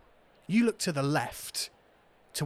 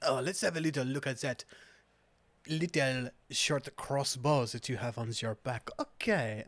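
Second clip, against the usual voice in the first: put-on French-accented shopkeeper inspecting goods; 3 semitones lower, 4 dB quieter than usual. Seller examining customer's crossbow, French accent. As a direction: muse over it as a French stallholder. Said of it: "I will do this accent".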